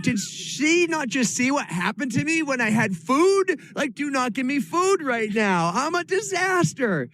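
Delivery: high-pitched mock disbelief; incredulous outburst. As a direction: act out appalled disbelief, voice shooting way up. Acted disbelief at being offered food.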